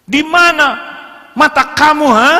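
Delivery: loud, high furious shout; mock husband yelling where are your eyes; angry husband. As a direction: snap at her like a raging husband.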